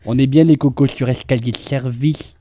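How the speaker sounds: slow, drawn-out celebrity impression; Dominique Besnéard. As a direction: draw the words out in a mannered caricature. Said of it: "il parle comme ça".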